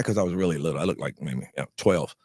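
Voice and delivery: usual speaking voice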